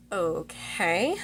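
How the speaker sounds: soft okay with a rising end; imitated nervousness. She answers his summons to the bedroom, nervously unsure. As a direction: voice a wary, drawn-out okay. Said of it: "she's super nervous".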